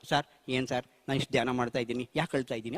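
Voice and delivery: low, soft, troubled questioning; mildly acted helplessness, voice dropped; retold subdued plea. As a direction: replay confiding in a teacher, hushed and low. Retells his troubled phone call.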